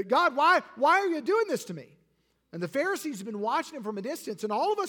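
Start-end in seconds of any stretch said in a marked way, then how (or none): 0.0-2.0 loud, higher wail of self-pity at God; preacher acting out a believer complaining to God; mock complaining believer. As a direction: ham up a whiny believer crying out to heaven. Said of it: "now we're complaining about what God did".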